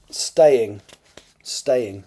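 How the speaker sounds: "staying" said slowly with a clear st; careful articulation. Shown as a demonstration. Author practising a misread word, "staying" carefully articulated. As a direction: sound the t in "staying" distinctly. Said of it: "I just obviously read it badly".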